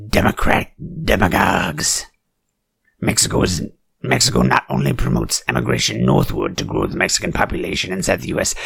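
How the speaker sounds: usual speaking voice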